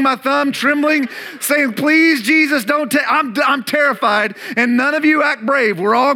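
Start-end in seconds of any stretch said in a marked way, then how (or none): 1.7-4.4 panicked plea. Pretend. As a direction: pretend to beg Jesus in terror, hammed-up. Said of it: "I am panicked".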